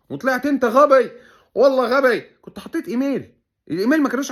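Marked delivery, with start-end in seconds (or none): 0.1-2.2 louder, slower "والله غبي" spelled out for him; exasperated scolding. Real, not acted. Mocking scold of Abu Kian. (توبيخ ساخر بصوت أعلى)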